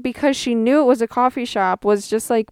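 ordinary talk, nothing marked

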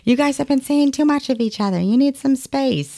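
high, imitated parent to kids; voiced parent. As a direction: play a parent separating squabbling kids. Parent voiced separating squabbling kids.

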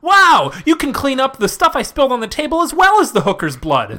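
high, loud mock amazement; amazed infomercial stooge. Customer marvelling at the ShamWow, hammed-up amazement. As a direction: gush like a planted customer.

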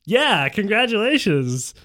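loud, high, slow congratulations; drawn-out, high echo of a cheer. Acted. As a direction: chime in heartily. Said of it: "Tom echoed".

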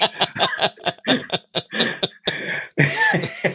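breathy high laugh. Real, not acted. Laughs at own story.

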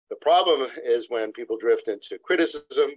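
usual speaking voice

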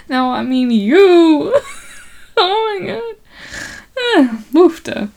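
giggling through a teasing correction; laughing. Real, not acted. Laughing as she teases the sailor about the wife.

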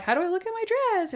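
lightly mocking dress question; mock show character. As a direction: play a fussy TV bride fishing for compliments. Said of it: "they're like".

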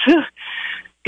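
apologetic nervous little laugh. Real, not acted. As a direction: laugh off the nerves. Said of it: "Sorry, I'm nervous".

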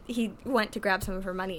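usual speaking voice

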